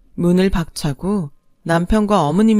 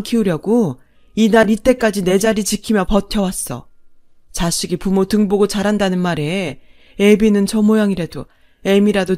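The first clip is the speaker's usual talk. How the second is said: louder, bitter and forceful; furious reckoning; 5 dB louder than usual. Acted. Mother-in-law finally telling off her husband, furious and bitter. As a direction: unload years of held-back anger.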